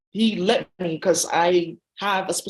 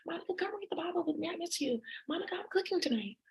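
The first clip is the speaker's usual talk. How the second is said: much higher, much softer mother's voice; mom voice; 9 semitones higher, 10 dB quieter than usual. Retold or imitated. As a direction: coo like a gentle mom. Voicing Monica's pleading mom.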